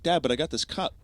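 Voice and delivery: put-on high-pitched child voice; pleading kid. Kid showing angry dad his cup, pleading. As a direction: imitate a kid appealing to dad.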